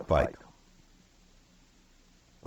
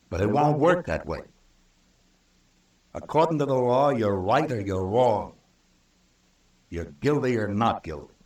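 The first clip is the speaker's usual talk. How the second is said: forceful declaration; 6 semitones higher than usual. Acted. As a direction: hammer home the rule of law. Marshal lays down the law.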